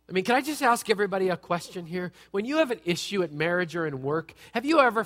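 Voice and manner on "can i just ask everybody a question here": ordinary talk, nothing marked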